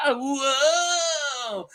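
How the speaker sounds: drawn-out high mock-tripping wail; Damon. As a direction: stretch it high, dazed by an acid trip. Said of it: "It's my impression of Damon".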